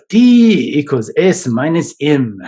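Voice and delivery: put-on slow, deliberate German Austrian accent. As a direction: imitate Frankl's Austrian German accent, slowly. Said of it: "in his German, Austrian accent".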